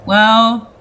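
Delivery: loud, sighed lead-in; sighing resignation. Acted. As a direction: sigh it out before explaining. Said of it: "Ismark sighs and goes".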